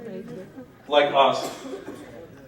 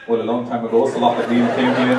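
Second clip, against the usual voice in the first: put-on old villager, much lower and calm; old man voice; 9 semitones lower than usual. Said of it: "he said".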